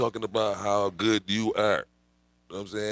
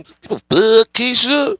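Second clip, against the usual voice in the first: much higher and louder, a brash put-on yell; defiant shout; 13 semitones higher, 14 dB louder, slower than usual. Mocking the defiant crowd, loud brash shout. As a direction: hurl it out like a cocky character.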